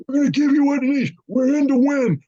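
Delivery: high, fast mock Biden voice. Mimicking Biden promising Ukraine victory, high mock voice. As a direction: squeak it out like a doddering leader. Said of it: "you have Joe Biden going".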